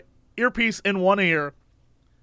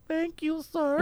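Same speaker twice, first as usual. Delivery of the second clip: tiny, soft, high voice; poor little woman; 7 semitones higher, 10 dB quieter, slower than usual. Retold or imitated. Cashier thanks the customer who stood up for her, poor little woman. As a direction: thank him meekly in a high, hushed voice. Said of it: "the woman who's cashing me out goes".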